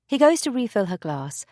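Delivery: usual speaking voice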